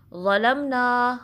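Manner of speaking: very slow drawn-out chant; slow tajweed recitation; Quranic recitation. Shown as a demonstration. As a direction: recite it slowly, stretching the vowels. Recites word slowly.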